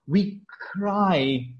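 "cry" set apart by a pause and drawn out; stress on cry. Shown as a demonstration. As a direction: lean on "cry" after a short pause. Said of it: "I want to highlight the word".

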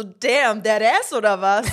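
excited exclamation. Retold or imitated. Acted reaction to motivational note.

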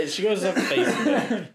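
giggling through the line; laughing as she talks. Real, not acted. Laughing about her mom.

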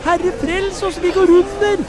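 high, loud cry for help; frightened cry. Acted. Disciples crying out to Jesus in the storm, high frightened shout. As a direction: shout it in panic as the boat sinks. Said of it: "ropte de".

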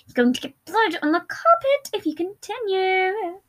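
high, slow chiding; scolding drawl. A narrator's reading. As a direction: draw the words out in mock concern. Cold abuser drawls at victim.

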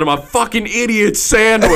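high, hammed-up humiliating line; mock Ramsay outburst. Podcaster retelling the chef's bread insult, mock outburst.

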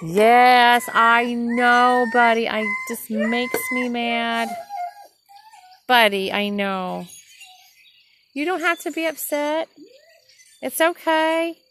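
calming Buddy down, high and loud; soothing pet talk. Real, not acted. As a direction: reassure the upset pup in a high, loud coo. Soothing the upset dog Buddy.

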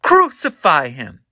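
high, loud mob chant; crowd crying out. Acted. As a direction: shout it twice as the angry crowd. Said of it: "they cried out, saying".

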